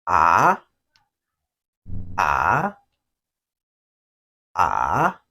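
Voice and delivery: AH dips low, then rises; third tone. Shown as a demonstration. Teacher models the third tone, falling-rising AH. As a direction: drop a little on AH and swing up. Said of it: "So it goes this way".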